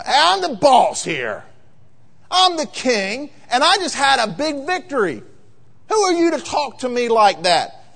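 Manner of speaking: high, forceful boasting; indignant, self-important royal bluster; puffed-up king. Acted. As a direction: throw your chest out and pull rank. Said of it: "somebody's chest out like this".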